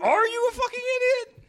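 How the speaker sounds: very high, loud mock scream; hammed-up outraged screech; yelling. Co-host replays napper's rant at neighbour, screaming. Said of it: "just yelling".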